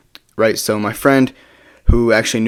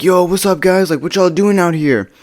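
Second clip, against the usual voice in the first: high-pitched imitation of a drunk guy; drunk, high partygoer; 7 semitones higher, 3 dB louder than usual. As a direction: play the drunk guy hailing people, pitched up. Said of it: "He's, like, super drunk and high".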